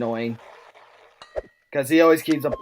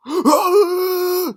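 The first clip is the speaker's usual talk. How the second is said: high rasping creature gasp; monster gasp. Acted. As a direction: gasp like a zombie. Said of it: "special infected impressions".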